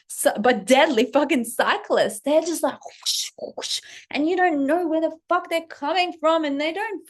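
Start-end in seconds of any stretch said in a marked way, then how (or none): 2.9-3.8 breathy whoosh of a bike flying past; voiceless rush of air; whooshing bike sound. Retold or imitated. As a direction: mimic the cyclists zipping by. Imitating cyclists speeding past.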